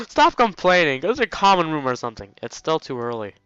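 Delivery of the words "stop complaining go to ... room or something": loud, high, exasperated outburst; exasperated scolding. Real, not acted. Player scolding the idle game hero, exasperated. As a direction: scold them, laughing a little as it bursts out.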